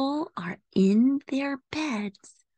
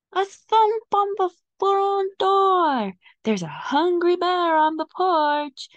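frightened; 9 semitones higher, 5 dB louder than usual. Acted. Spooked cat, loud high.

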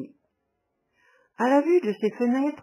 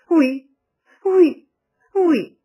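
much louder and higher, hard yes; harsh repetition; 7 semitones higher, 10 dB louder, much slower than usual. A narrator's reading. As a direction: hammer each yes harshly. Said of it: "répéta-t-il avec dureté".

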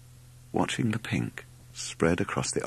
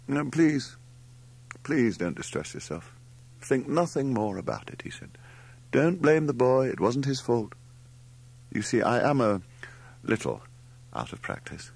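pained nonchalance; 6 semitones higher than usual. Acted. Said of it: "smile of pain-racked nonchalance".

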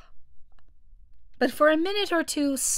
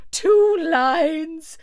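loud, high exclamation; excited cry; 7 semitones higher, 9 dB louder than usual. A narrator's reading. Mock Turtle jumps in on the Griffin's dance instructions, excited cry. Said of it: "cried the Mock Turtle".

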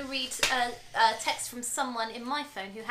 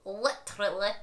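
low-pitched Scottish "Literally"; Scottish pronunciation attempt; Scottish accent; 7 semitones lower, 4 dB quieter than usual. Shown as a demonstration. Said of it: "How would you say literally in Scottish?".

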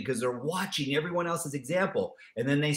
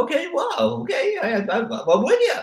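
much higher, laughing, thrilled reply; hammed-up delighted friend; amazed listener; 9 semitones higher, 4 dB louder than usual. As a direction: gush over the good news. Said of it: "They go".

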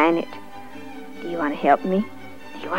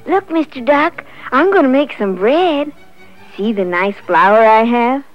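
higher, louder, proud showing-off; excited hen; 5 semitones higher, 7 dB louder than usual. A narrator's reading. Little Red Hen shows Mister Duck her flour, excitedly. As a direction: call the duck over excitedly.

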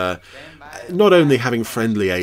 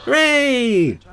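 high, bright-eyed hammed-up hooray; mock cheer; 4 dB louder than usual. Commentator cheering the seal's rescue, hammed-up hooray. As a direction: cheer it like a delighted kid.

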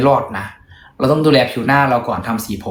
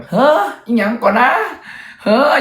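put-on much higher, chattering old-woman voice; gossipy old maid; 12 semitones higher than usual. Mimicking old housekeepers gossiping in the hall, high mock voice.

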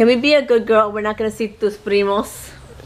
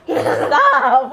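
ordinary delivery

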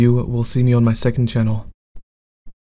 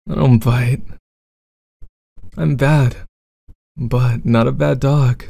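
bad boy roleplay.

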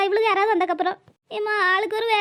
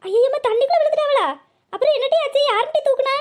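alarmed; 4 semitones higher than usual. Acted. Hears of a fall into water.

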